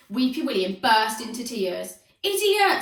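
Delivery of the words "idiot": high, loud furious scream; high, loud angry scream. A narrator's reading. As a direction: yell from the touchline. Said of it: "screamed Aerobic Al's dad".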